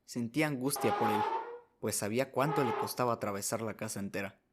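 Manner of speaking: usual speaking voice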